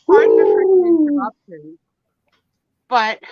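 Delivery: loud, playfully indignant barge-in; mock outrage. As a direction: crash the show with hammed-up indignation. Bursting in late, mock outrage.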